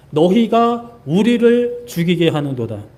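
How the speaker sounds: slow, heavy, accusing reading of 우리를; slow accusing repeat. Retold or imitated. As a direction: drag out the blame word by word. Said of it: "이렇게 말씀합니다".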